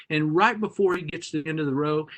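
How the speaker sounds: ordinary talk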